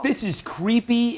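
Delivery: mock outrage, high and slow at the start; faked outrage. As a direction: open on put-on indignation, then settle into explaining. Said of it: "am I faking my outrage enough?".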